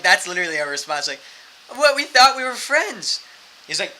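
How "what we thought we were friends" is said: loud, high, stretched-out disbelief; mock-hurt plea. Friends answering a threat to kill, mock-hurt plea. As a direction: protest slowly as if wounded.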